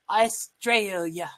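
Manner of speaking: drawn-out attempt at an Australian accent; amateur Aussie accent, stretched out. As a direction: say it slowly in a put-on Australian accent. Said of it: "an Australian accent".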